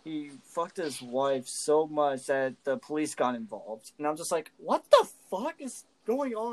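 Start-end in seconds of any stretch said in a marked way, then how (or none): 4.6-6.5 higher-pitched, incredulous exclamation; mock-appalled outburst; retold bewilderment. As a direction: act out baffled disbelief. Retells stunned reaction to scene.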